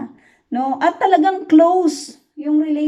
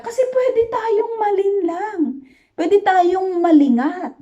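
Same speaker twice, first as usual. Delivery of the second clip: emotional plea; 7 semitones higher than usual. Real, not acted. Preacher pleads, voice breaking.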